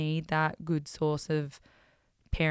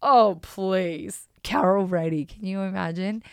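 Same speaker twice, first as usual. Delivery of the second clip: mum's mock scoff, louder; 2 semitones higher, 6 dB louder than usual. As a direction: scoff like a mother brushing it off. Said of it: "my mum goes".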